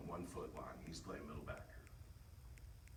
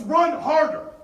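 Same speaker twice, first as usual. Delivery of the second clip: loud shout; 25 dB louder, slower than usual. Shown as a demonstration. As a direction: Run harder, yelled at full force, very high. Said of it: "No, it's".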